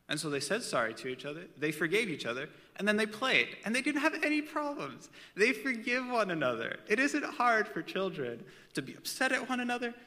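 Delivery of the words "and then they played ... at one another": higher, louder, building zeal; rising enthusiasm; preaching it up; excited preaching. Real, not acted.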